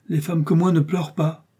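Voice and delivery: high-pitched, haughty rebuttal; proud retort, pitched well up; proud denial. A narrator's reading. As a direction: lift the voice and refuse any pity. Milady proudly denies having wept.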